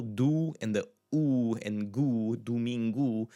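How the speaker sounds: "do" as doo, "gu" as goo, then "domingo"; slow teacher's "doo-MEEN-goo"; O as U sound. Shown as a demonstration. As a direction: break "domingo" into its oo syllables. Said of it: "more of a U sound".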